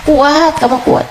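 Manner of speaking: ordinary talk, nothing marked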